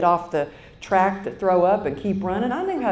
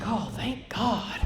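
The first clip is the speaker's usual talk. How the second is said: drowsy relief; 3 semitones higher, 5 dB quieter, slower than usual. Retold or imitated. Drugged relief as sedation given.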